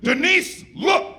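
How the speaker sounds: sharp, high, loud shout of the name; angry yell. Retold or imitated. Boyfriend yelling at girlfriend in a heated argument, loud.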